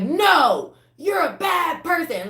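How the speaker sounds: high, loud, insistent yell; mock shout. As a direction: yell it like a bully. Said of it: "they're like".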